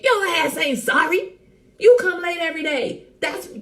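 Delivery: loud, high teasing outrage; mock scolding. Teasing someone who is always late, mock scolding. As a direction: playfully tell them off at high pitch.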